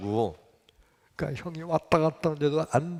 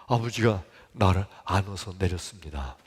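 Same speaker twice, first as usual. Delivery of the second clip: ordinary talk, nothing marked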